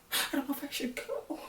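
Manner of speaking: high, soft breathy mock of a hesitant mother; hammed-up falsetto whimper of not wanting to leave; mocking dramatic mother. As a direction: parody her overacted reluctance, up high and hushed. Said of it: "this mother was acting his ass off".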